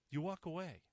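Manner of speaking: usual speaking voice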